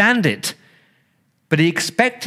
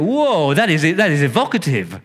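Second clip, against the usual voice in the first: amazed whoa; 3 semitones higher than usual. Pretend. Preacher shows the church how to react, amazed. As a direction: let the whoa jump up in awe. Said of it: "you're supposed to go".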